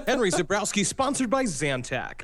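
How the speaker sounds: swooping, over-keen commercial read; put-on pitchman patter; spoof ad pitchman. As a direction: sell it like a cheesy TV spokesman.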